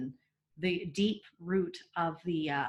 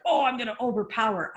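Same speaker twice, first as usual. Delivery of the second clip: mock power play; 3 semitones higher, 5 dB louder, faster than usual. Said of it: "You're like".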